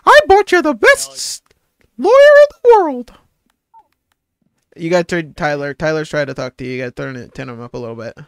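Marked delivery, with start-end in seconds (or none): 0.1-3.1 high squeaky cartoon mouse voice; bright mouse voice, pitched way up; Mickey Mouse. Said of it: "practicing my Mickey impression".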